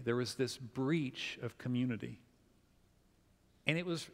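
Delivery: ordinary talk, nothing marked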